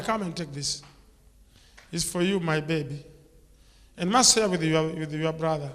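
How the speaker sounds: ordinary talk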